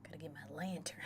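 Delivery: soft, low mumble to oneself; quiet self-talk while busy; low aside. Pretend. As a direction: say it under your breath. First take, then livelier repeat.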